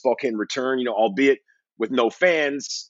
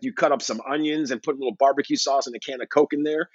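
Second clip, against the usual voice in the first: ordinary talk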